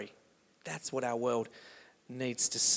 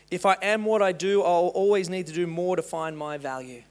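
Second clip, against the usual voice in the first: loud emphatic quote reading; 4 semitones higher, 7 dB louder than usual. Acted.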